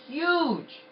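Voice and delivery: "Huge" pitched very high and loud, falling; emphatic HUGE. Real, not acted. Repeats the word to show size.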